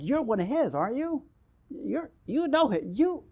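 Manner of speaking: high, prying voice of suspicious onlookers; accusing bystanders. Retold or imitated. Bystanders by the fire spot Peter, high-pitched accusing.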